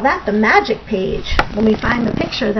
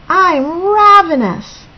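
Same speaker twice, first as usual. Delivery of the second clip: loud, higher, drawn-out complaint; desperate hunger; 6 semitones higher, 5 dB louder, slower than usual. Acted. Hungry Bird begs Raccoon for food, desperate and drawn out. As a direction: stretch it out with rising desperation.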